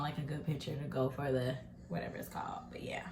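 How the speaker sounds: whispered aside. Real, not acted. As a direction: murmur it under your breath. Whispering while plating.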